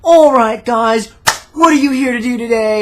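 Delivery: mock the overly peppy skydiving cameraman; energetic instructor.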